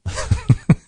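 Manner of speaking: high wheezy giggle; real giggle at a funny word; helpless high chuckle; amused laugh. Real, not acted. Laughing at the mispronunciation.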